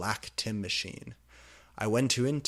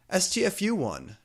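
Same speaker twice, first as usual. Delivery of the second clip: high, loud snapped letters; angry shout; 9 semitones higher, 5 dB louder than usual. Acted. Teacher shouting down Draco, angry. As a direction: yell it at the class. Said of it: "shooted Cornelia Fuck".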